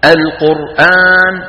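slow القرآن with the "aa" held long; measured elongation example. Shown as a demonstration. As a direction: draw out the final vowel of القرآن. Recitation example of lengthening. (القرآن بمد البدل)

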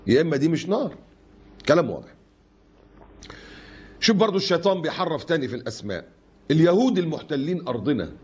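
ordinary delivery

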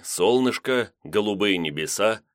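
ordinary delivery

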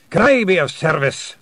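loud, high voice with drum-roll R's; Gonagle's rolled R's. A narrator's reading. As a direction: announce yourself loudly, rolling the R. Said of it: "sounding his R's like a drum roll".

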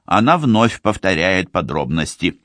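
plain reading voice

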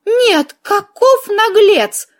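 high, indignant outburst; scandalised, stretched-out protest; outraged exclamation. Acted. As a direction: exclaim at the sheer cheek, pitch jumping up. Outraged at count's smug answer.

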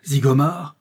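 nom répété en cri, voix projetée; s'écrier en reconnaissant l'ennemi; exclamation. A narrator's reading. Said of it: "s'écria Paulin Broquet". (cri de stupeur)

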